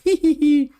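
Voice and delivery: put-on giggly kid voice. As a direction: giggle it out like a delighted sick toddler. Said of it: "like, laughing".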